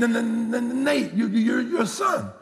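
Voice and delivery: high stammered plea, imitating the son; stuttering son identifying himself. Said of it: "you know he stuttered".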